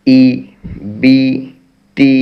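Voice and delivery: ই, বি, তি with the mouth drawn downward; short, clear i sounds; jer vowel demo. Shown as a demonstration. Teacher showing students the jer mouth position. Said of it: "মুখের অবস্থা হবে একটু নিচের দিকে".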